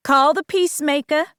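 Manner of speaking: mother ordering her daughter to call the sheriff, firm. Acted. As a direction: give a sharp command. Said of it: "Mama ordered".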